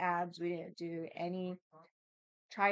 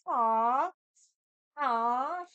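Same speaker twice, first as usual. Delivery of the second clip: loud, high, melting aww; gushing aww at a sweet gesture; touched aww; 5 semitones higher, 8 dB louder than usual. Retold or imitated. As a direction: coo at her like she's adorable. Touched by kind bank teller.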